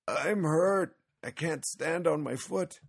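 put-on high, pained wail of an old man; whimpering and wailing. A narrator's reading. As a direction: wail pitifully about the injury. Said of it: "He whimpered and wailed".

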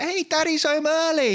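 put-on high child voice, excited; kids' voices. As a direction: pipe it up like little kids. Kids greeting dad in pitch.

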